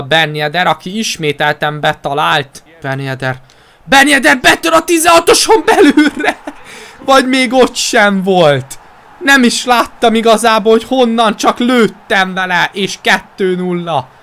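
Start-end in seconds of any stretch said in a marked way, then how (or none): 3.8-14.1 excited Hungarian sports commentary. Real, not acted. Second goal commentary.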